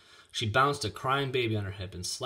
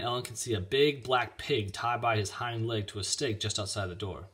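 ordinary delivery

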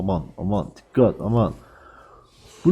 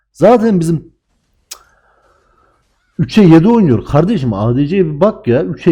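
high, louder frustrated rant; angry rant; 5 semitones higher, 5 dB louder than usual. Real, not acted. Streamer raging at his ADC to viewers, angry rant. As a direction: vent about the teammate's 3-7 score.

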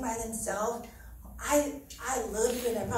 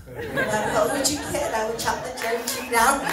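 loud, laughing mock threat; playful tough-mom boast, laughing loudly; 2 semitones higher, 6 dB louder than usual. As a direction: threaten the boys through laughter. Joking threat to her tall sons.

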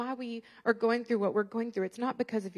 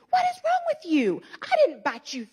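loud, high, furious scolding; angry yelling at daughter; 3 semitones higher, 8 dB louder than usual. Retold or imitated. Mother yelling at her daughter, furious and loud. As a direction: yell it in her face, outraged. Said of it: "I stormed in that room".

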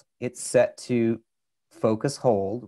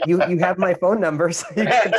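laughing while joking, higher and louder; 7 semitones higher, 6 dB louder than usual. Real, not acted. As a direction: laugh through the words. Joking about phone support.

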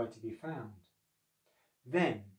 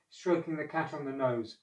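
ordinary delivery

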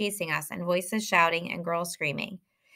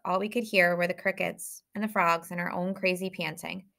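ordinary delivery, nothing marked

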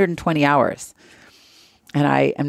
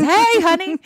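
much higher, louder sing-song greeting; imitating mom; 4 dB louder, slower than usual. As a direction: chirp it like a carefree mother. Mom greeting her from car.

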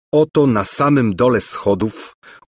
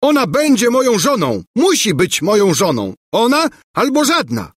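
a hammed-up roar, much higher and louder; 12 semitones higher, 3 dB louder than usual. A narrator's reading. Bulbo roaring at rival prince, mock furious. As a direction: bellow your claim like a jealous fool.

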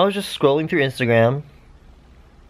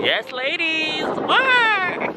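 much higher, hyped cheer; much slower than usual. Real, not acted. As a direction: hype the ladies up. Cheering on dancing girls.

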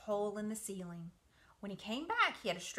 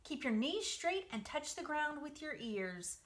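calling out; 7 semitones higher than usual. Acted. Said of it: "called Charlotte".

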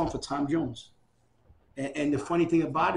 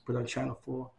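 usual speaking voice

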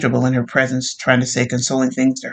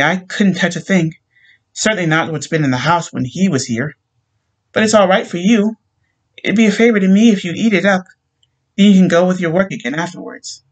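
higher, insistent pleading; pleading widow; 6 semitones higher than usual. A narrator's reading. Widow urges policemen to eat the lamb, higher and pleading. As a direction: press them until they give in.

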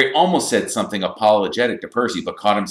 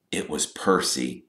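ordinary delivery, nothing marked

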